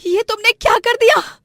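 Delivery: very high, loud wail of shock; horrified cry. Acted. Wife sees her kitchen wrecked, cries out horrified. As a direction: cry out in horror.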